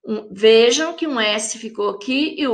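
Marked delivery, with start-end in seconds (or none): none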